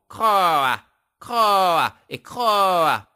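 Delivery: high, loud, very slow caws; crow caws. Acted. Three crows falling on the woodcutter, drawn-out caws.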